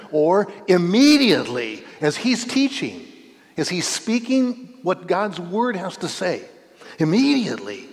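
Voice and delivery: preacher stressing immediately; urgent. Real, not acted. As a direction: press the urgency of the moment.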